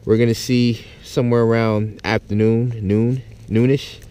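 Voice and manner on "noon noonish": ordinary talk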